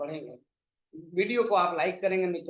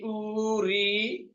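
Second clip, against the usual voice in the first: ordinary talk, nothing marked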